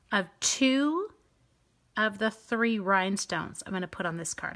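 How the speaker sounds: ordinary talk, nothing marked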